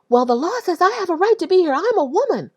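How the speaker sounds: imitating the man. Speaker imitating the man defending his right, high and insistent. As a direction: mimic his defiant claim, pitched high. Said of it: "And he said".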